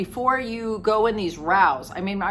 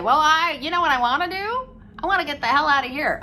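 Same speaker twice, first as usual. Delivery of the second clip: defiant retort; 5 semitones higher, faster than usual. Retold or imitated. Addicted child snapping back at parent, defiant. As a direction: toss it back like a fed-up teen. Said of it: "And they say".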